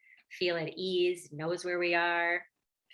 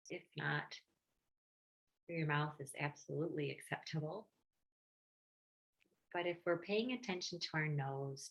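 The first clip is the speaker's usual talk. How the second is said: guided meditation; 2 semitones lower, 6 dB quieter than usual. Real, not acted. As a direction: gently guide listeners inward, leaving space between phrases.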